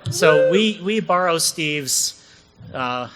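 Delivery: put-on a stretched Boston accent on the safe harbor line; a light Boston accent imitating Steve. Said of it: "That's my best Boston accent for Steve".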